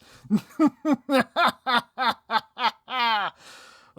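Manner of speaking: loud, high villain cackle; evil cackle. Acted. Villain gloats over the hero's dilemma with a loud cackle. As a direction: throw back your head and cackle.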